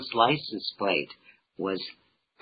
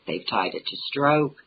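ordinary talk

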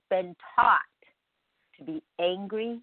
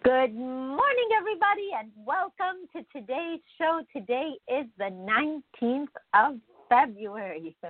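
morning show host; 5 semitones higher than usual. Real, not acted. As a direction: welcome listeners like a host.

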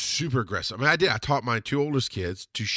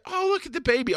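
high-pitched cooing imitation; mock a stranger fussing over a baby; gushing strangers; 13 semitones higher than usual. People coo at the baby.

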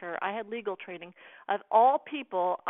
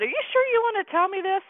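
high, fast and louder, alarmed; alarmed disbelief; 11 semitones higher, 7 dB louder, faster than usual. Retold or imitated. Hearing a scam confession.

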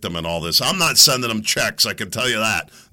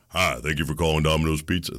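Domino's phone greeter; 11 semitones lower, 4 dB quieter, faster than usual. Retold or imitated. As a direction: lower the voice into a smooth pizza-line greeter. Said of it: "I could do this pretty accurately".

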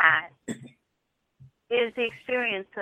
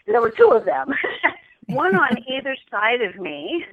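laughing; 3 semitones higher, 8 dB louder than usual. Real, not acted. Embarrassed laugh over word slip.

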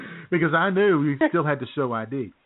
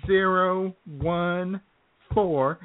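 very slow, higher, each number drawn out; slow typing out; 7 semitones higher, much slower than usual. Retold or imitated. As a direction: read the numbers like slowly typing them. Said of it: "then she says".

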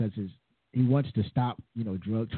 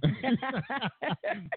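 high laugh; 12 semitones higher than usual. Real, not acted. Laughing at own joke.

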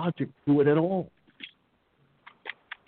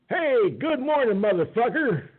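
higher, slow sing-song greeting; mock cheery greeting; 12 semitones higher, slower than usual. Shows how harmless speech gets censored, in a higher, slow, cheery greeting. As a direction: drawl it out like a sweet hello. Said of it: "You could just say".

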